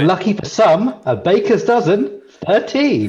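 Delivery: high, sing-song number call; bingo caller. Pretend. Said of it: "I feel like a bingo caller".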